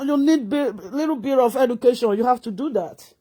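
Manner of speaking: normal talking voice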